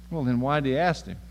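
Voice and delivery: ordinary talk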